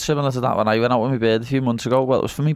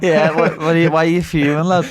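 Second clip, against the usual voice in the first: louder, higher mock outburst; mock angry retort; 6 semitones higher, 5 dB louder than usual. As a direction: play the indignant mate who thinks he's being attacked. Mock quarrel with gym mate.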